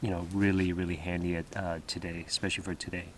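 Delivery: hushed morning talk at camp; quiet voice. Real, not acted. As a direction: keep it low so nobody wakes. Early morning, others still sleeping.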